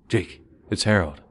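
scared, trembling radio call; a hint of fear, held low; low shaky voice. A narrator's reading. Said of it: "in this low, shaky voice".

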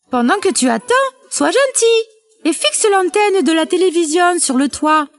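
voix de poule haut perchée; mère poule autoritaire. A narrator's reading. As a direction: demande un service, dans l'aigu. (poule de conte à la voix aiguë)

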